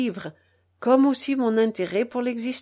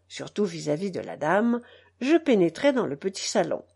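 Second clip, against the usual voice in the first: usual speaking voice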